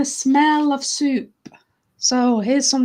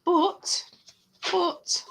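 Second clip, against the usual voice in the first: higher, drawn-out story narration; once-upon-a-time telling voice; storytelling; 4 semitones higher, 4 dB louder, slower than usual. Acted. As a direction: tell it slowly to children, big on key words. Tells story to children.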